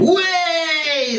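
a high mocking shout of Way!; teenagers' sarcastic yell; sarcastic cheer. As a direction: cheer him on with a sneer. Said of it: "a sarcastic cheer".

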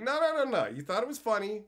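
lightly hammed-up refusal; teasing protest. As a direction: insist they found it funny. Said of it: "it's like".

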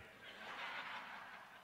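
short breathy laugh. Real, not acted. Preacher laughing at his own story about the toddler.

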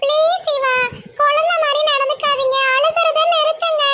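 very high-pitched gentle plea; pleading young woman. Acted. Munis asking Siva to stop crying, high-voiced pleading. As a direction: plead with him in a high girlish voice.

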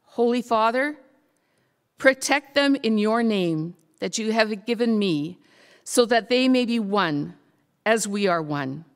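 ordinary delivery

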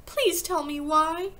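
slow, high pleading; drawn-out, high-pitched plea; desperate cry. A narrator's reading. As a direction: beg as a distraught child. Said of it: "Matilda cried".

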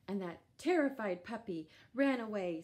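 ordinary delivery

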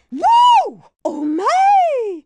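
high, loud startled granny shout. Acted. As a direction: yelp as the car lurches forward. Old lady startled by speed.

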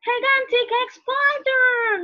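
high, loud panicked shriek; acted panic. Scared Jason spotting a gigantic spider, panicked. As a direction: shout in escalating, high-pitched terror.

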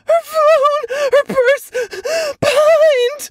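high, loud, frantic panic; shrill with hysteria, near hyperventilating; hysterical. Acted. Mother hysterical about her missing daughter, high and loud. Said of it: "She's so hysterical that she's almost hyperventilating".

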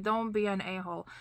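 ordinary talk, nothing marked

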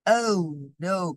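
mild storyteller's cry of alarm; dismayed cry. A narrator's reading. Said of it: "cried Fox".

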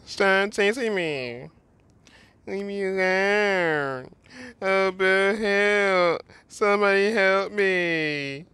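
high, slow panicked pleading; being chased. Pretend. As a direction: whine like a scared kid fleeing. Player mimics fleeing kid pleading.

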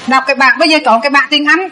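ordinary talk, nothing marked

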